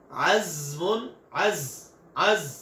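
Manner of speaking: अज़्मुन with a thin, whistling z; Arabic zay, thin and whistling; thin whistled za. Shown as a demonstration. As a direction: say अज़्मुन with a sharp hissing za. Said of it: "जा की आवाज बारीक और सीटी वाली".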